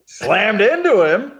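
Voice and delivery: a touch of Norm Macdonald's drawl; mild Norm Macdonald impression. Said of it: "That's my Norm Macdonald".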